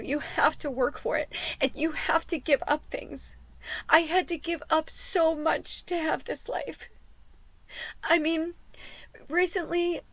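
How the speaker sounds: crying over what she gave up; teary. Real, not acted. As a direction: hold back the sobs. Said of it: "I knew I was gonna cry".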